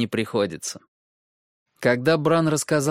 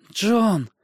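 gasped name; 10 semitones higher, 4 dB louder than usual. Acted. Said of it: "выдохнул Бран".

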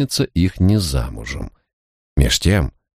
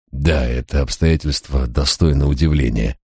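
ordinary delivery, nothing marked

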